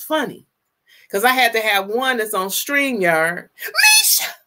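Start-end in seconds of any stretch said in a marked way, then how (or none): 3.6-4.3 very high, shouted greeting; thrilled call-out; excited shout. Real, not acted. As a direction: yell her name with joy. Greets a viewer by name.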